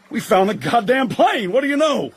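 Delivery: excited. Acted. Finding the missing plane.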